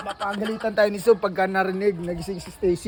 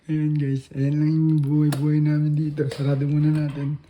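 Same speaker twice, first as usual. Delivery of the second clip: quiet at night; 9 semitones lower, 5 dB quieter than usual. Real, not acted. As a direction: keep it low, someone's sleeping. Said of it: "hinaan lang natin boses kasi baka ma".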